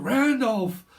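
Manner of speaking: high, loud call of the name; mock film shout. Reader quotes a film character calling Randolph, shouting. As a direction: call out as if hailing someone. Acting out remembered film exchange.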